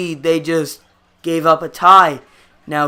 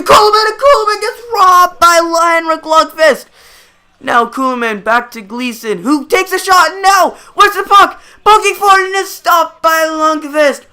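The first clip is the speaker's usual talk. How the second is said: high, louder, quickening call of the chaos; rising scramble excitement; 8 semitones higher, 3 dB louder than usual. Real, not acted. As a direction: build with the scramble in front of the net. Scramble in front of the net.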